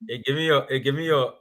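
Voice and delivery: ordinary delivery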